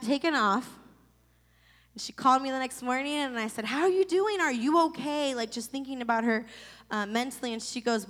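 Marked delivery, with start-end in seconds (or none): 3.7-5.3 worried. Retold or imitated. Worried call after surgery.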